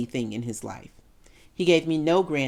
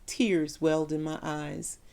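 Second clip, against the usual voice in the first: ordinary talk, nothing marked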